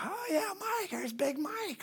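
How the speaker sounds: high, soft falsetto; small, high-pitched mock awe; awed little voice. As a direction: squeak it out softly and high. Acting himself greeting big Mike.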